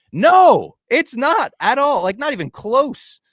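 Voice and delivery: loud, high, drawn-out denial; emphatic rebuttal. Real, not acted. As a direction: burst out with an incredulous, emphatic correction. Emphatic answer to imagined prediction.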